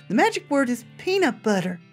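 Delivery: sweet and unsettling talking doll; too calm creepy doll. Acted. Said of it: "its mouth begins to move".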